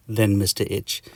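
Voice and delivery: ordinary delivery, nothing marked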